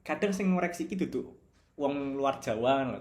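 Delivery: ordinary delivery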